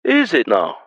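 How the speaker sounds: lightly voiced Highlander, Scottish brogue. A narrator's reading. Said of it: "perfect Scottish brogue".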